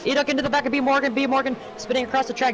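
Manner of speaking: high, fast crash call; excited shout. Real, not acted. Commentator reacts to crash.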